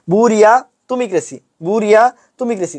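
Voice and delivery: chunk it as "buria", pause, "tumi kresi"; broken up for spelling. Shown as a demonstration. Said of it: "একটু ভাঙাইয়া পড়বা".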